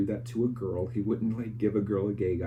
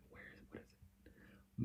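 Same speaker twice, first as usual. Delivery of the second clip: whispered aside to herself; half-voiced thinking aloud, barely audible; soft whispered mutter; 21 dB quieter, slower than usual. Real, not acted.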